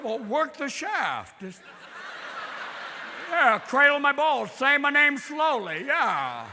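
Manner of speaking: slow, stammering drawl; halting aw-shucks leading man; hammed-up Jimmy Stewart stutter. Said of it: "Jimmy Stewart".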